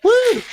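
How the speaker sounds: very high, loud "woo"; high whoop. Retold or imitated. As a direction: let out a quick falsetto whoop. Imitates own relieved escape.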